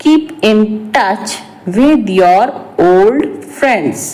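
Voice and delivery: slow, broken into short phrases; slow repeat. Shown as a demonstration. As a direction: say it slowly for learners, pausing before the last words. Repeats translation slowly for learners. (धीरे-धीरे, रुक-रुक कर)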